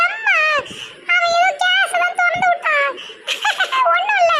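very high, helpless giggles; possessed laughing. Acted. Daughter explaining the gas to mother, laughing. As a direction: squeal reassurance through the laughter. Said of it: "பேயெல்லாம் சிரிக்குது இப்படி".

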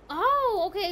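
high, loud "oh"; surprised realization. Real, not acted. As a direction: suddenly catch on. Understands game mechanic.

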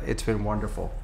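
ordinary talk

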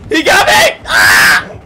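high, loud shrieks breaking into a laugh; half-scream, half-laugh as he grabs you; panicked shrieks. Real, not acted. Grabbed player shrieking for help, panicked laughing screams.

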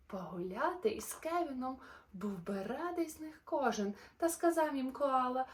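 lively, sing-song storybook narration; animated bedtime-story voice; reading to children. A narrator's reading. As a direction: read the rhymes brightly to little listeners. Reads a rhyming children's story.

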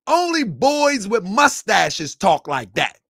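ordinary talk

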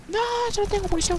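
very high, fast wail; high-pitched rushed alarm; panicked squeal. Real, not acted. Out of ammunition under attack.

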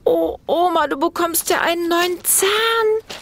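put-on high, astonished child voice; amazed little boy. A narrator's reading. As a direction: marvel at grandma like a small boy. Felix staunt über Omas Zahn. (hohe, staunende Kinderstimme)

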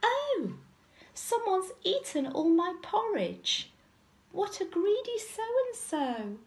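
high squeaky little bear; high-pitched childish complaint; Baby Bear. A narrator's reading. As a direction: squeak it out, upset cub. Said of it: "Baby Bear squeaked".

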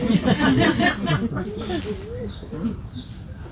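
a high, delighted laugh; tickled laugh at the story's twist; hearty laugh. Real, not acted. Laughs after Vishnu's gentle reply.

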